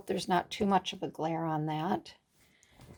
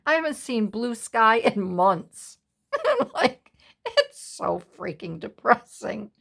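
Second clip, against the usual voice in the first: laughing; 4 semitones higher, 5 dB louder than usual. Real, not acted. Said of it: "Oh, I just had to laugh".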